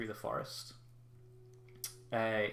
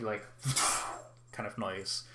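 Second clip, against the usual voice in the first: usual speaking voice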